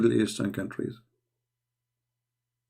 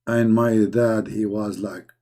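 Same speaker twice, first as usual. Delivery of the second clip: normal talking voice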